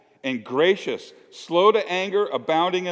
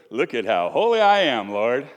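mock pious; 3 semitones lower, 4 dB louder than usual. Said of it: "He's being all pious".

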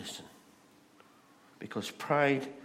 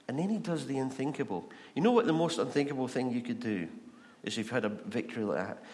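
ordinary delivery

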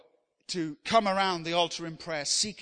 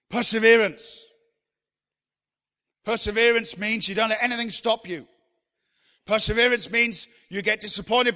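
louder, driving preacher's repetition of Perseverance; preaching at full force; 3 semitones higher, 8 dB louder than usual. Acted. Preacher exhorting the congregation, loud rhythmic preaching. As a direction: hammer each line home from the pulpit.

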